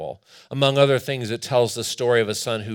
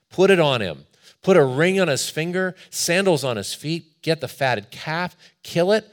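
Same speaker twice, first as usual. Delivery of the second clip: raised, urgent father's commands; excited orders; 5 semitones higher, 5 dB louder than usual. Acted. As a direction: call out joyfully to the servants. Father calls out orders to slaves.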